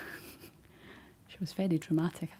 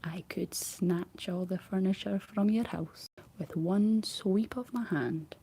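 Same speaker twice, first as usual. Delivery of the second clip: usual speaking voice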